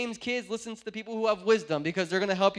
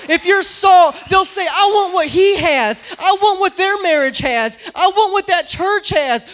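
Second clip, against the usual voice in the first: much louder, higher preaching, slowing on key lines; preaching at full force; 8 semitones higher, 17 dB louder than usual. Acted. Preacher voicing grandma's advice, full-force preaching. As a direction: drive the message home to the church.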